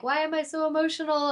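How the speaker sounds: retold crying in a high voice; high, lightly imitated weepy wail; mock tearful. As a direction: act out being overwhelmed with tears. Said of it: "I was just crying".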